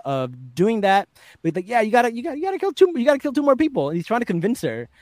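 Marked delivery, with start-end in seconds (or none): 1.7-3.9 pushy demon. Retold or imitated.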